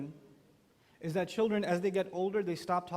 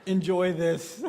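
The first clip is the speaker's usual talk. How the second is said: knowing laugh; 7 dB louder, much slower than usual. Retold or imitated. Parents of teens answering.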